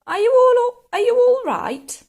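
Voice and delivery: high, loud polite enquiry; polite asking. A narrator's reading. George checking on his frozen Grandma, high polite question. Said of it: "George asked her politely".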